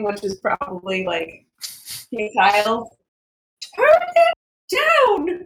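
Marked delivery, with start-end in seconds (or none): 3.8-5.5 high, loud shout over music. Acted. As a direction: yell it over blaring music. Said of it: "is going to be just, like, shouting".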